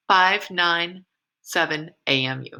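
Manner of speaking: ordinary talk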